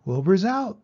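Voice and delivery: loud, high burst of news; excited horses. A narrator's reading. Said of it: "they said".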